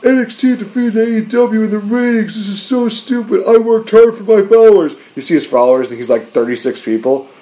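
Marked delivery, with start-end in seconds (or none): 0.0-4.9 much higher, whining voice; whiny tweeter. Retold or imitated. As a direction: complain like an indignant online nobody.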